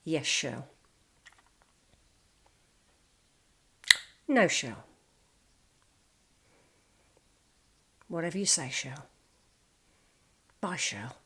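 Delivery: quiet, resigned yes-dear replies with long pauses; meek henpecked husband. Retold or imitated. Quiet boss answers wife Shirl on the phone, meekly. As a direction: mumble "Yes, Shirl" softly, giving in. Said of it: "because he'd go".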